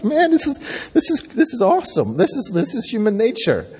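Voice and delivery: high, lightly hammed-up marvelling; mock amazement. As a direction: imitate an impressed onlooker. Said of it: "You're like".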